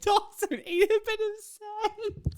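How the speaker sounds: incredulous. Real, not acted. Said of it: "What are you talking about?".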